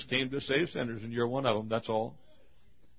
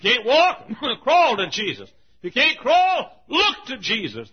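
high, loud pulpit cry; fervent revival exhortation; exhorting preacher; 12 semitones higher, 11 dB louder than usual. Acted. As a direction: urge them on at full voice.

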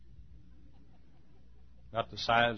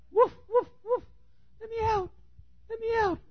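very high little-dog voice begging; squeaky high dog voice pleading; talking Chihuahua. Acted. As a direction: whine to get out like a caged lapdog. Chihuahua voice again.

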